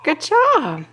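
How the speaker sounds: high, slow, delighted cheer; excited praise. Real, not acted. Parent praises toddler for finding it, excited praise.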